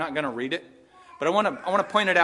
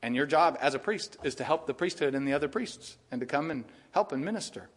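ordinary talk

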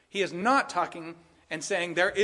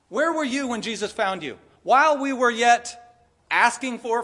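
higher, louder, driving sermon voice; full-force preaching; 5 semitones higher, 4 dB louder than usual. Acted. Pastor urges the church to forgive, fervent loud preaching. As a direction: preach it hard to the room.

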